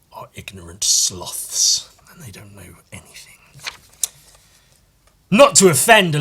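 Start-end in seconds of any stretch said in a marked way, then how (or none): 0.1-3.3 whisper. Real, not acted. Insulting Americans under the breath, whispered. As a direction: hiss a cheeky insult.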